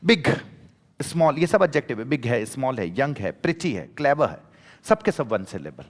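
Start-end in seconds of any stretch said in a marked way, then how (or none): 0.1-0.3 बिग as a single uninterrupted beat; one unbroken syllable. Shown as a demonstration. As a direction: say बिग in one go, without stopping inside. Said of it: "बीच में कहीं रुको नहीं".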